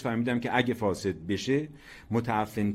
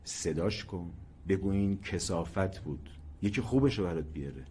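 soft, lower confidential instruction; murmured in the ear; 3 semitones lower, 6 dB quieter than usual. A narrator's reading. Grandfather advising the child under his ear, hushed. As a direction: keep it hushed and close. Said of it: "زیر گوشم گفت".

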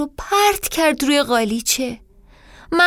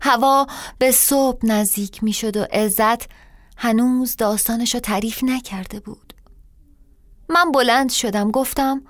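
ordinary delivery

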